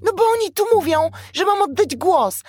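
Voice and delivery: very high, loud, indignant squawk; shrieking finch. A narrator's reading. Upset finch explaining to the owl, shrieking. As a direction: protest at the top of a tiny bird voice. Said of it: "rozległ się wrzask Zięby".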